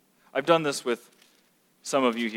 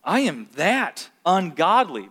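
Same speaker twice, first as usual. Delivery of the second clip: convicted; slower than usual. Retold or imitated. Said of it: "You should walk away going".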